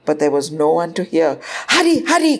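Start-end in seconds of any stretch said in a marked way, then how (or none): 1.7-2.4 put-on high, urging child voice; coaxing boy. A narrator's reading. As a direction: coax someone along anxiously. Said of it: "coaxed Angad".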